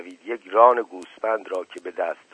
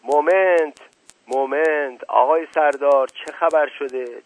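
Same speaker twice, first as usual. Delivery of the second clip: louder, higher call to break up the quarrel; shouting; 5 semitones higher, 5 dB louder than usual. Acted. Shazdeh shouts at the angry Sardar to stop, loud call. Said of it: "فریاد زد".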